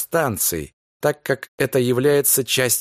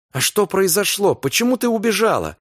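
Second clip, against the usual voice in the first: anxious questioning; 7 semitones higher than usual. Acted. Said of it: "С тревогой в голосе".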